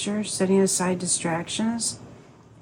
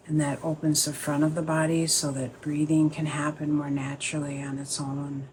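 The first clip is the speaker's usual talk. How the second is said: calm, unhurried meditation guide, pausing between phrases; guided meditation. Acted. Teacher easing the group into a sit, guided meditation voice.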